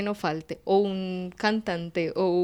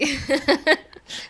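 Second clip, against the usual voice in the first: higher, loud burst of laughter; real high-pitched laugh; 6 semitones higher, 5 dB louder than usual. Real, not acted. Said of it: "por qué se ríe tanto".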